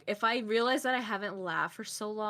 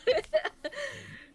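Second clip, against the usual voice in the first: real laugh, pitched way up; giggling at the compliment; high burst of laughter. Real, not acted. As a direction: let a big laugh out.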